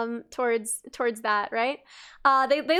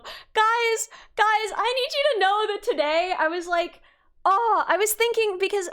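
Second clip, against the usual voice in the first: higher, eager call to the audience; excited; 5 semitones higher than usual. Real, not acted. Vlogger calling out to her viewers, high and eager. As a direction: grab the viewers' attention.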